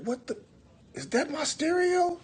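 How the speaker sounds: high, slow outraged disbelief; shocked girlfriend. Retold or imitated. Quoting the chick hearing her stereo, high shocked voice. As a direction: squeal it like she can't believe her ears. Girl hears stereo blasting.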